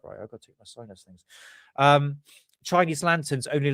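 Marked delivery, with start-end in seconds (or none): 0.0-1.2 stuffy blocked nose. Real, not acted. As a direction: talk through a bunged-up nose. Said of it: "I'm getting bunged up".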